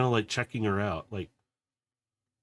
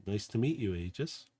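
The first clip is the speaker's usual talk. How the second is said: gentle greeting; 8 dB quieter than usual. Acted.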